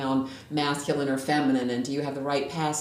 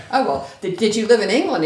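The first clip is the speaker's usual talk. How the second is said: higher, louder, eagerly curious question; perked-up surprise; 8 semitones higher, 11 dB louder than usual. Retold or imitated. Retelling to a friend how she perked up at the British woman, higher and louder. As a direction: perk up as if suddenly interested. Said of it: "I kind of perked up".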